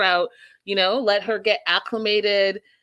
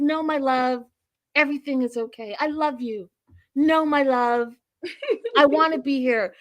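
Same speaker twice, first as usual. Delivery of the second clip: hammed-up lovey-dovey wife; mock reassuring wife; 5 semitones higher than usual. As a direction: imitate her soothing her husband, laying it on thick. Imitates wife reassuring husband.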